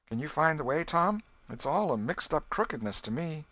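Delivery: lost and pleading for help; anxious. A narrator's reading. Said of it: "Becky grew apprehensive".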